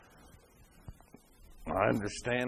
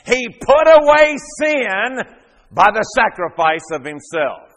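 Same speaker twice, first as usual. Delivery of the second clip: high, loud, slow preaching that keeps climbing; fiery pulpit preaching, slow and loud; full-force preaching; 8 semitones higher, 12 dB louder, slower than usual. Acted. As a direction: drive it home from the pulpit, building.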